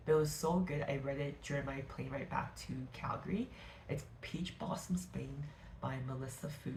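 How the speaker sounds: ordinary talk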